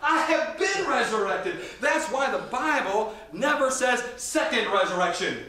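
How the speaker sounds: high, emphatic pulpit delivery; full-force preaching. Real, not acted. Preacher correcting the translators to the congregation, full-force preaching. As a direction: drive it home from the pulpit, key words punched high.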